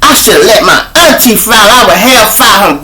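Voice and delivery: high, loud, scornful whine; disgusted. Retold or imitated. Baby mama griping about the lost money, in a high disgusted whine. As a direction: sneer the words with contempt. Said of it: "with such disgust".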